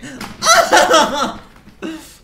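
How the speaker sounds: a high, loud burst of laughter. Real, not acted. Streamer cracking up at her own magnet joke, loud laugh. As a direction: let a big laugh out.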